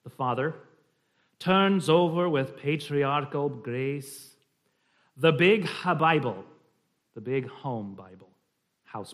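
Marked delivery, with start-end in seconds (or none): 1.4-4.3 slow, measured Scottish verse; slight Scottish accent. Shown as a demonstration. Reading of the father's grace, slight Scottish accent. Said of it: "a slight Scottish accent". 5.2-6.5 louder Scottish verse reading; slight Scottish accent. Shown as a demonstration. Reading a Scots poem to the group, slight Scottish accent. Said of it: "a slight Scottish accent".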